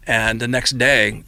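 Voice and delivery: ordinary talk, nothing marked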